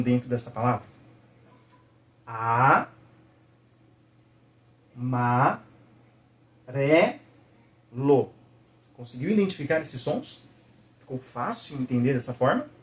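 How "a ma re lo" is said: "A, ma, re, lo" broken into separate syllables with long pauses. Shown as a demonstration. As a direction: sound out "A, ma, re, lo" slowly, one syllable at a time. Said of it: "vamos tentar sentir quantos sons eu tenho". ("A, ma, re, lo" sílaba por sílaba, com pausas longas)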